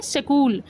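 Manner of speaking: "सकूल" said as two syllables, sa-kool; Haryanvi pronunciation. Shown as a demonstration. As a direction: put a short a after the s in "सकूल". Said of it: "Haryana में बोलते हैं".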